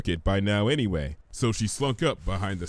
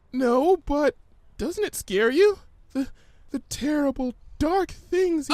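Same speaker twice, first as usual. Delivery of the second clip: higher, halting, faltering; a touch of fear. Acted. Fluttershy tries to name Gummy's threats, faltering. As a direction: trail off in dread.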